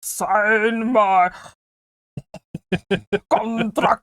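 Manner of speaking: slow, breath-heavy Nosferatu impression broken by a laugh; heavy-breathed villain voice with a laugh; breathy vampire voice. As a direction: breathe the words out like a creepy count, cracking up. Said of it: "The breathing, the breathing is out of hand".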